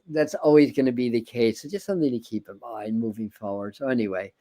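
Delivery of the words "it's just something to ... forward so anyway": ordinary talk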